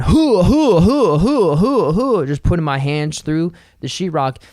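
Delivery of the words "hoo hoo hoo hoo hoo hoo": loud, higher punching hoots; hyped grunting hoots. Retold or imitated. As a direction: hoot with each punch, pumped up. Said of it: "videos of me just going".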